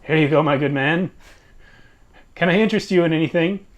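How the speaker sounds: cheerful eager innkeeper; friendly host voice. Acted. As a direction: offer it brightly, a touch fast.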